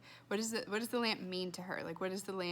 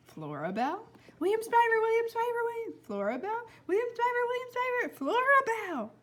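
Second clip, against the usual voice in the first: put-on high, loud boy calling back; a boy calling out over and over; excited boy calling; 12 semitones higher, 4 dB louder than usual. Blind boy answering from his window, high excited calls.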